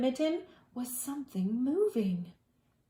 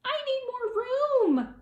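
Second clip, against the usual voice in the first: much higher, louder squeaky complaint; squeaky mouse; 5 dB louder than usual. Acted. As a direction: squeak it like a squashed little mouse. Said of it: "he thought".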